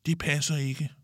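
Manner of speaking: high-pitched objection. A narrator's reading. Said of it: "siger Mille højt".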